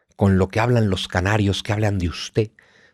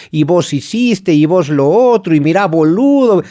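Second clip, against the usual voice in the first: put-on higher, louder drawn-out Argentine accent; 6 semitones higher, 5 dB louder than usual. Explaining Argentine Spanish with a hammed-up Argentine accent. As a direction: stretch it out like a porteño. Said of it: "El argentino que habla un poco más alargado".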